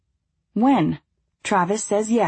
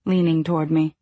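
usual speaking voice

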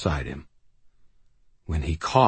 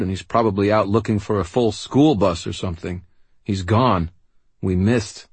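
loud, raised, frustrated; bitter outburst; 5 semitones higher, 4 dB louder than usual. Acted. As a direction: throw the words back at him, furious. Harry bitterly refuses to leave.

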